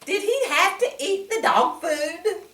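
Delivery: ordinary delivery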